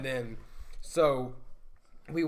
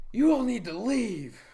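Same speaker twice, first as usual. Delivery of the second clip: put-on high, loud, slow bossy kid; bossy girl voice; 8 semitones higher, 7 dB louder, slower than usual. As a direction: order them off slowly. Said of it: "so the girls are like".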